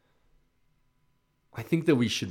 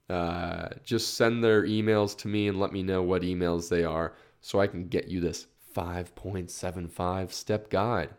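ordinary talk, nothing marked

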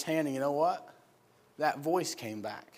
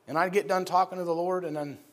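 ordinary talk

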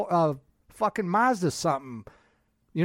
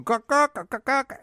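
higher squawking gawk-gawk; mock gawking noise; 8 semitones higher than usual. The rambling clerk mocked as higher squawking gawks. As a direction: squawk it like a chattering bird. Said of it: "he's just going".

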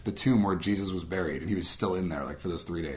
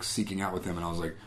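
usual speaking voice

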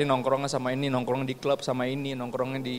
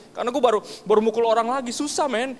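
high, fast mock-tearful confession; imitated tearful panic; 7 semitones higher, 3 dB louder than usual. Crying friend confesses to pastor he hit someone, mock tearful panic. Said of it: "Dia, dia nangis-nangis".

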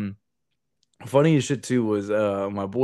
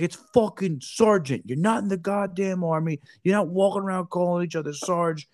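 higher, hammed-up furious demand; mock angry sergeant major; 9 semitones higher than usual. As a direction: chew out the Marines. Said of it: "He's like".

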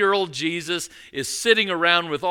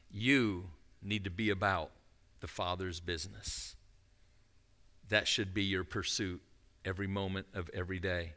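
much softer, intimate sermon voice; quiet earnest preaching; 8 semitones lower, 10 dB quieter than usual. Acted. Pastor urging the congregation, much softer and sincere. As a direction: drop to a hush to drive it home.

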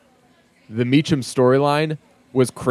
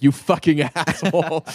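higher mock outrage breaking into laughter; imitated all-caps outrage; 4 semitones higher than usual. Reading out sister's furious text to co-host, mock outrage, laughing. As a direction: laugh through a retold angry text. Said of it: "text from my sister in all caps".

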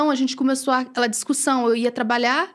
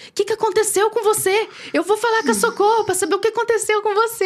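worried husband; 9 semitones higher than usual. Retold or imitated. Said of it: "ele falava".

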